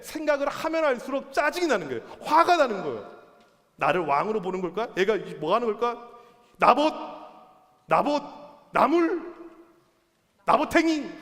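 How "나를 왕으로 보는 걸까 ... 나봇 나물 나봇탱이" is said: stew, then spit out each mocking name louder; mounting anger. King stewing over Naboth's refusal, acted mounting anger. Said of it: "막 계속 화가 나는 거예요".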